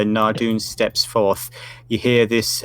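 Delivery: ordinary talk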